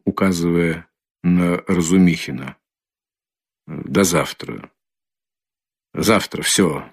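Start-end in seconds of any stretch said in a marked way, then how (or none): 3.7-6.8 low, slow, halting words; broken voice. A narrator's reading. Raskolnikov dismissing his family until tomorrow, broken voice. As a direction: let each short phrase drop after a long pause. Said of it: "прерывистым голосом".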